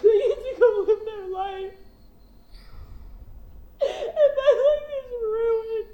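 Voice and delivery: real tearful sobbing with broken laughs; fighting through tears while venting; crying. Real, not acted. Crying while telling of betrayal.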